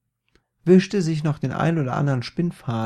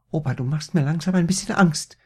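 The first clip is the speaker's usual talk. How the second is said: ängstliches Mädchen; 4 semitones higher, 5 dB louder, faster than usual. A narrator's reading. Maria hat Angst vor Opa.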